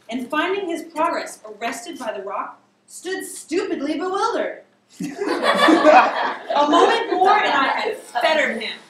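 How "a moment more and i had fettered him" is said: laughing. Real, not acted. Narrator laughs while reading.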